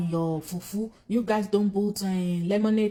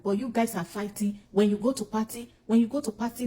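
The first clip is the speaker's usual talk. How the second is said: ordinary talk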